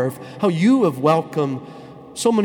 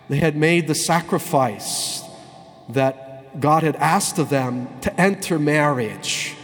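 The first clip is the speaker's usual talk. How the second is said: usual speaking voice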